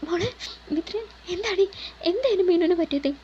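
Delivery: high, anxious pleading questions; desperate to know what happened; panicked. Acted. Atharv begs Mithra for news, high and panicked. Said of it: "അതൊരു പരിഭ്രമത്തോടെ ചോദിച്ചു".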